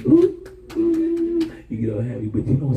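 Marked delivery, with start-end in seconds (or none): 0.1-1.5 high, loud excited squeal; giddy excitement. Retold or imitated. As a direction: squeal like a happy believer. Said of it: "you go".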